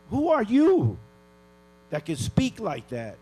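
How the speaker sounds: indignant. Real, not acted. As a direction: demand who they are. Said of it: "I'm really pissed off".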